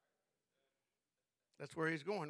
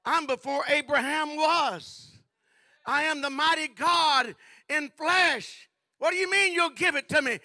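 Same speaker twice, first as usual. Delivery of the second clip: mighty shout; 8 semitones higher, 13 dB louder than usual. Acted. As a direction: proclaim it like thunder from the pulpit. Jesus rebuking Satan in sermon.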